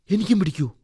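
put-on high child's voice, wondering; high-pitched childlike question; curious little boy. A narrator's reading. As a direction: ask like a worried small boy. Boy asks if he will sicken.

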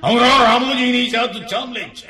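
loud, high, slow declamation; stage drama acting. Shown as a demonstration. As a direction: thunder it out like an old stage-play actor. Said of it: "scene act చేయొచ్చు". (రంగస్థల నాటక శైలిలో బిగ్గరగా, ఎత్తైన గొంతుతో)

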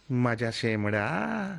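imitating an affectionate coo, very slow and drawn out; प्यार भरा लाड़. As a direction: stretch it out slowly like a fond pet name. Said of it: "मुझे ना बड़े प्यार से बोलते थे". (लाड़ से खींचकर, धीरे-धीरे बोलते हुए नकल)

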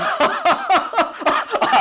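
high burst of acted laughter; hearty laugh. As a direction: laugh out at the silly plea. Thief laughs at granddaughter's plea.

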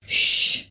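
unvoiced hushing sound; soft shush. Hushing a weeping patient, soft shush. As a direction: hush her gently with a breathy shh. Hushing the crying old woman.

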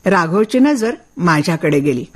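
ordinary delivery, nothing marked

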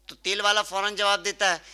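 normal talking voice

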